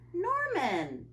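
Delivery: high exclamation falling away; startled cry. A narrator's reading. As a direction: call the name out in surprise. Child cries out fish's name at night.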